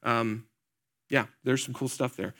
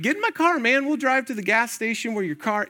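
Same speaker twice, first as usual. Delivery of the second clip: much higher-pitched retelling of himself; excited offer; 9 semitones higher than usual. Retold or imitated. Offering a stranded guy a ride, high-pitched and eager. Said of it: "I'm like".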